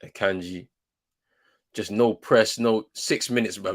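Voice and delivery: ordinary delivery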